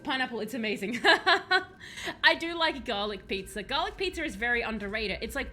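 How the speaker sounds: usual speaking voice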